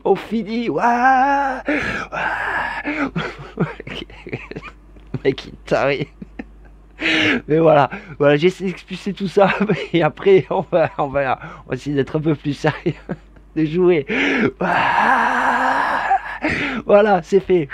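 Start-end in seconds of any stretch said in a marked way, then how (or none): 0.7-2.8 high laughing shout. Real, not acted. Laughing fit. 14.6-16.2 high laughing cry. Real, not acted. Laughing fit again.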